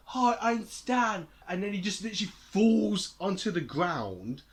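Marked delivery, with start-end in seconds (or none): 0.1-1.3 higher, slow, drawn-out hello; mock friendly publicist hello; acted Stan greeting. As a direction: stretch the greeting out, slow and bright. Retelling Stan's entrance, acted greeting.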